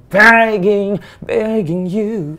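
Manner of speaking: hammed-up pleading; mock begging. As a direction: exaggerate the begging, stretching each word. Mockingly sings out the begging.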